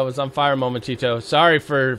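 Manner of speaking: normal talking voice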